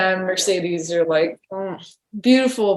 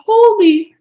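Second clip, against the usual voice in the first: much higher, louder startled outburst, reenacted; shocked exclamation; 11 semitones higher, 7 dB louder than usual. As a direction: blurt it like the award just hit you. Retelling her on-stage cuss moment.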